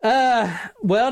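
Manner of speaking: ordinary talk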